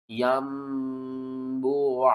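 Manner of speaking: यम्बुआ drawn out in chant, noon turned into meem; long, measured tajweed reading; slow recitation. Shown as a demonstration. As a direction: chant it very slowly. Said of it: "किस तरह".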